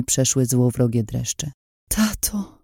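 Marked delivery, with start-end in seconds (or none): 1.9-2.6 soft, high, quavering call; trembling whisper. A narrator's reading. Daughter calls to her caged father, trembling hushed voice. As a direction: say it softly, voice trembling. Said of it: "szepnęłam drżącym głosem".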